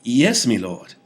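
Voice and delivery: high, loud eager agreement; nodding hard, overeager to please; eager vizier. A narrator's reading. Vizier eagerly agrees with the king. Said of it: "nodded his head so powerfully".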